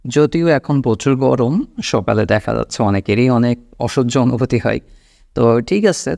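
ordinary delivery

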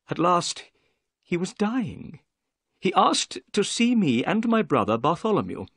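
high-pitched little man recounting events; nervous little man. A narrator's reading. Little man recounts his father's last days, nervous. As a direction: carry on the tale in a high voice. Figure of Thaddeus Sholto.

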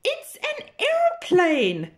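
high, thrilled reveal; excited discovery. Acted. Pig finding the aeroplane in the barn, thrilled. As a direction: gasp it out in wonder.

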